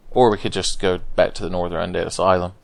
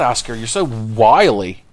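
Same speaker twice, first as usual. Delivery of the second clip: usual speaking voice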